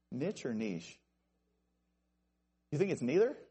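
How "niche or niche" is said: first "niche" as nitch, second "niche" as neesh; two pronunciations of niche. Shown as a demonstration. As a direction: weigh "niche" with short i against "niche" with long ee. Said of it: "I don't know".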